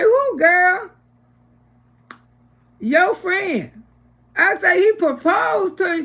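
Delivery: high, loud, thrilled questions; excited surprise. Retold or imitated. To the newly engaged friend: thrilled high disbelief. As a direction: squeal the news back in delight. Hearing friend got proposed to.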